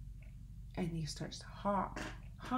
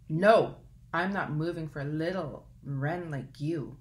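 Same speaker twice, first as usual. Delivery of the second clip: crabby elk; 6 dB louder than usual. Acted.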